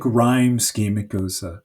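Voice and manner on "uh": ordinary delivery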